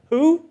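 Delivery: "who?" thrown up high and loud; spring the question on the room; rhetorical question. Real, not acted. Preacher quizzes the congregation about the verse, sharply rising.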